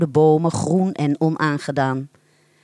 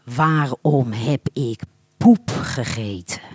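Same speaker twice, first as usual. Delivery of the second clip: echoing inner voice; 5 semitones lower, slower than usual. Acted. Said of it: "dreunde het tegen zijn trommelvliezen".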